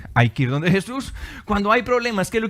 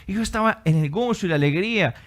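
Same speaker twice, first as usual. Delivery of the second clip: ordinary talk, nothing marked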